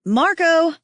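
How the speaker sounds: high playful call across the snow. Acted. Liz calling to her friend, playful loud call. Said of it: "Liz calls".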